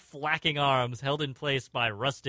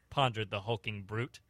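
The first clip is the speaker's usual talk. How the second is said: plain reading voice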